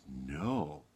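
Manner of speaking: lightly voiced low, soft shepherd; understated ominous shepherd; grave shepherd. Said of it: "And the shepherd said".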